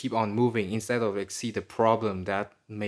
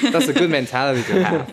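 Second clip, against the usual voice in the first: "mentality" said louder as a correction; corrected repeat; 3 semitones higher, 7 dB louder than usual. Real, not acted. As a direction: restate "mentality" louder after the slip. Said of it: "Sorry. Yeah".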